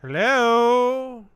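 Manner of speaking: loud high call. Real, not acted. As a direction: shout into the empty room. Calling out to vanished lion.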